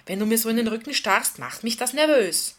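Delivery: exasperated, emphatic and loud; irritated retort. A narrator's reading. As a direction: push back with rising irritation. Irritated Lisa answers back loudly.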